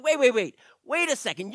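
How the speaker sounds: quick, urgent cutting-in wait wait wait; excited interruption. Acted. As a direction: jump in to stop him mid-sentence. David interrupts the overly technical Lenz.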